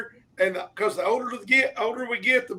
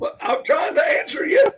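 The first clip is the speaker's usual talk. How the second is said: higher-pitched, half-shouted comeback; indignant protest; 8 semitones higher, 3 dB louder than usual. Real, not acted. Co-host defending himself to needling host, indignant. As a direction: protest loudly at being rushed.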